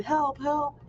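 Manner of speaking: mock scream for help. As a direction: call out like a stuck kid. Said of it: "banging on the ground and screaming".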